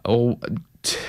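usual speaking voice

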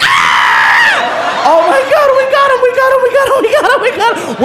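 gleeful cheering. Retold or imitated. Friends in the hallway, cheering the prank. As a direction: crow it like you just won.

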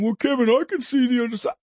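high, quick mock reasonable voice; lightly voiced wheedling critic; mocking persuader. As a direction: parody the opponent's reasonableness. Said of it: "guilt you into going".

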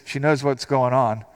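ordinary talk, nothing marked

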